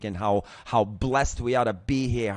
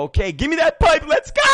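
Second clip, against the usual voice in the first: loud, high acted-out battle cry; psyched-up shout; 11 dB louder than usual. Retold psyching himself up to start.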